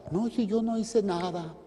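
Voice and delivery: high-pitched defensive excuse; imitating an indignant excuse-maker; mock protest. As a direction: put on a high voice, protesting innocence. Mimics someone excusing himself.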